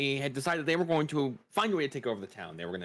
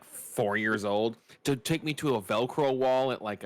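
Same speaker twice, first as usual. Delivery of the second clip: ordinary talk, nothing marked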